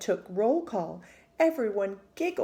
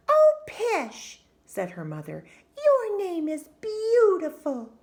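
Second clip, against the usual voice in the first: much higher, loud, gushing mother; doting mother; 10 semitones higher, 7 dB louder than usual. Acted. Mother dismisses the teasing, gushing over her daughter's name. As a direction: gush it to wipe away her worry.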